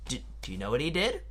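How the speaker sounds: ordinary delivery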